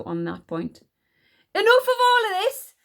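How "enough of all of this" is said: high, loud outburst; snapping. A narrator's reading. Queen cutting off the argument, snapping angrily. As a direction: snap it out at full temper. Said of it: "she snapped".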